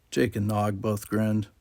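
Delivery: usual speaking voice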